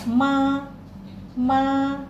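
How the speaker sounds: Ma high, level and long; first tone Ma. Shown as a demonstration. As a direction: hold Ma high and flat. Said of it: "dibacanya tinggi, datar, dan panjang".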